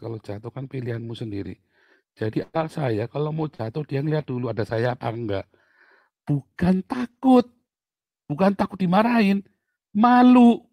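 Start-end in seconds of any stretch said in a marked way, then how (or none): 6.3-10.6 high, loud, slow emphasis; emphatic. Real, not acted. Preacher stressing to parents, high and loud. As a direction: drive home that it is shame, not fear.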